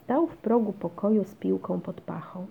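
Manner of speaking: plain reading voice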